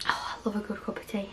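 soft unvoiced whisper to herself; whispered aside. Real, not acted. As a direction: breathe it out as a private aside over tea. Quiet aside while sipping tea.

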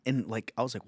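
usual speaking voice

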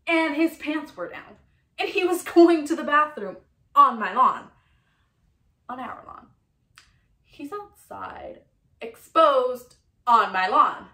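loud, high-swinging comic indignation; outraged rant. Acted. Woman recounts catching a man on her lawn, loud outrage. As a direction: blast each phrase in scandalised disbelief. Outraged monologue, recalling screaming.

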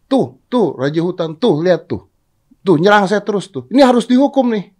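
high, loud tattling donkey; accusing donkey. Acted. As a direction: whine to the king for punishment. Donkey accusing tiger to king. (keledai mengadu kepada raja)

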